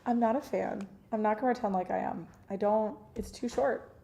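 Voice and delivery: normal talking voice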